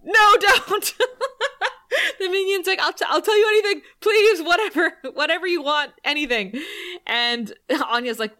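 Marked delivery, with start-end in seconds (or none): 0.0-6.6 high, loud, laughing pleading; squeaky panicked minion, cracking up; begging minion. Retold or imitated. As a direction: gabble the begging through laughter. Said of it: "he immediately is like".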